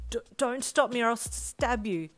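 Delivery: high, loud, stuttering threat; scared stutter. A narrator's reading. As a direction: stammer it out, terrified. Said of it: "you stuttered".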